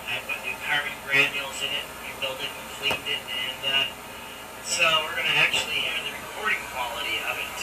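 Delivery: thin, hissy carbon mic recording; gritty early microphone. Real, not acted. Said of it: "except for the carbon hiss".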